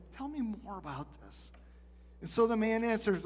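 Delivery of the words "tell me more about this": soft, much quieter caring ask; gentle invitation. Retold or imitated. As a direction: lean in and ask kindly, hushed. Jesus inviting father to share.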